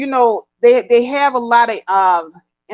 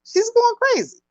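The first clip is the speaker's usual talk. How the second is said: mock frantic cry; 12 semitones higher than usual. Mock wailing about Kim's meltdown.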